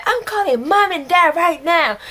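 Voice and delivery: high, heated tattling; mock angry threat. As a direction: imitate a fed-up sibling threatening to tell. Brother retold, slamming door mid-quarrel.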